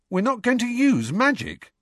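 put-on high, loud boyish exclaim; exclaiming loudly. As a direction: blurt it out loudly. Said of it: "Ron exclaimed loudly".

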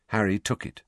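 ordinary delivery, nothing marked

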